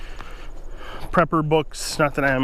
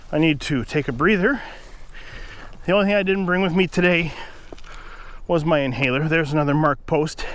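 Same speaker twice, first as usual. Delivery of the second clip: normal talking voice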